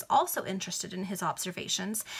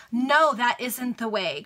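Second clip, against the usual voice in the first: slow, louder protest; protesting cry; 3 semitones higher, 5 dB louder, slower than usual. Acted. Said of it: "cried Sir Cherry".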